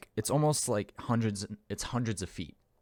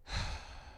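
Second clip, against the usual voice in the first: quiet, contented breath out; soft sigh; 8 dB quieter than usual. Acted. Mother resting her head on father, soft sigh.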